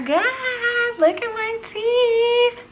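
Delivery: high, slow, thrilled squeal; excited. Real, not acted. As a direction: show off the new teeth with delight. Excited showing off new teeth.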